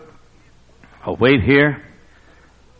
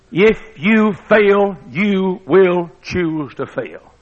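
drawn out, each word weighed; slow emphatic warning; 4 semitones higher, 4 dB louder, much slower than usual. Acted. Preacher warning the listeners, slow and deliberate. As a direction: lay it down slowly and heavily.

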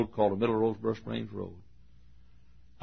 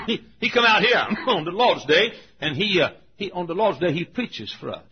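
higher, louder Southern dialect; imitated Southern deacon; 7 semitones higher, 5 dB louder than usual. Deacon telling visitor about Sunday preaching, raised Southern dialect. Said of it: "This is the way all of us Southerners talk".

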